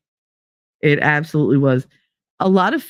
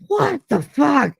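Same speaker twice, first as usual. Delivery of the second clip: high, slow retold "what the"; mock stunned outcry; shocked exclamation; 10 semitones higher, slower than usual. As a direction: drag it out in disbelief. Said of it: "And I was like".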